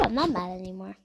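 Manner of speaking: usual speaking voice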